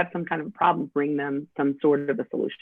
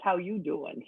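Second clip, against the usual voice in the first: New York greeting; 9 dB quieter, slower than usual. Retold or imitated. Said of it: "back where I'm from".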